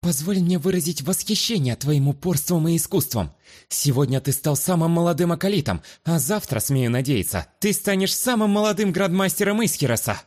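lightly sultry, high and cooing. Acted. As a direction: flatter him with a languid purr. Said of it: "томным голосом".

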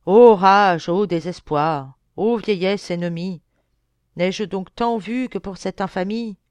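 tragic declamation. Acted. Man mocking actors who declaim alone on stage, declaimed. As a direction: declaim it like classical tragedy, loud attack on the opening. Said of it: "qui se met à déclamer".

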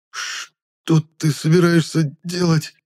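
slow, high, teeth chattering from cold. A narrator's reading. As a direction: shiver the words out through chattering teeth. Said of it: "выдохнул узник, стуча зубами".

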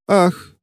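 high, pitiful theatrical ah; plaintive sigh. A narrator's reading. Witch sighing pitifully at the clerk. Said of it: "жалобно выдохнула Ремора".

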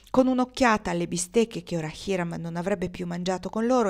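ordinary delivery

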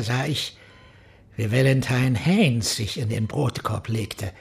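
ordinary talk

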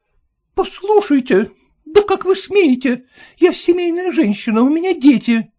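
high, scandalised protest; how dare you; lady's voice, outraged. A narrator's reading. Lady outraged.

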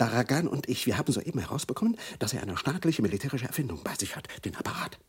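hushed urgency. Acted. Secret phone call continues.

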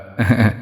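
soft sneaky snicker; a quiet scheming chuckle; sly laugh. Acted. Imp snickers slyly at the sister. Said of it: "Hai nanh cười gian xảo".

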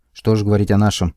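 ordinary delivery

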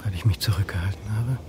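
whispered regret. Acted. Man whispering regrets to absent Anne, breathless whisper. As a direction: murmur it to a lost love.

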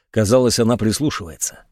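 ordinary delivery

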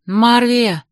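high call to someone nearby. A narrator's reading. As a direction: call her name to get attention. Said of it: "позвала я женщину".

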